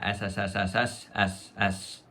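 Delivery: long hissing esses, snake-like; drawn-out s like a snake. Shown as a demonstration. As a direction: hiss out a string of s sounds. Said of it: "Lots of S's".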